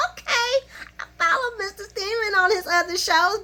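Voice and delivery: put-on high-pitched fan-girl gushing; swooning approval. Fan admires him in sunglasses, swooning approval. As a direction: pitch way up, tickled by the eye candy. Swooning over good-looking actor.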